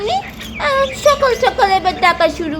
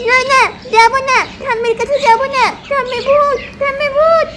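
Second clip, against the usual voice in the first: put-on higher, frightened little boy's wail; scared child; 7 semitones higher than usual. Child refusing to go near ghost granny, higher frightened wail. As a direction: plead not to be sent to granny.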